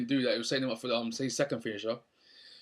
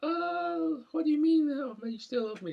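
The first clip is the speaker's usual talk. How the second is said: much higher, squeaky girly imitation; mock-surprised girlfriend, way up high; Brooke voice. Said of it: "Brooke goes".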